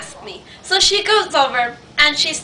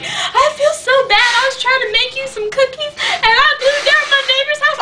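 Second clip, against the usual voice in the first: very high, hammed-up tearful confession; mock wailing; 12 semitones higher than usual. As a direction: whine it like an imitated distraught friend. Friend wails a guilty confession.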